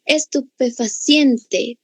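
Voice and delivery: "Estupefaciente" slowly, with CIEN held; stress on penultimate syllable. Shown as a demonstration. Teacher showing the stressed syllable, "Estupefaciente" slow with CIEN stressed. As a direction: draw out "Estupefaciente", landing on CIEN. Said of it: "penúltima sílaba".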